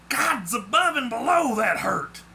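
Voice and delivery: high, startled cry of pain; pained exclamation. A narrator's reading. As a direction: curse aloud after a nasty jolt. Character jolted backwards cries in pain.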